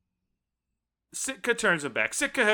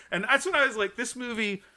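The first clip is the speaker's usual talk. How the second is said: normal talking voice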